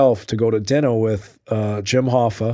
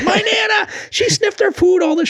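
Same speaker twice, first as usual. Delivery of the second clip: mimicking Mickey. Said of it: "He goes".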